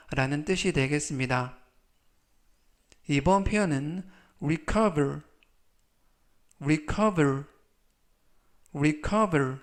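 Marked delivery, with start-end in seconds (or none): 4.4-9.6 careful model of "recover" as ri-KUH-ver; teacher's clear demo of "recover"; pronunciation model. Shown as a demonstration. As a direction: say "recover" with the middle syllable stressed. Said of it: "이라고 발음하시면 되겠고요".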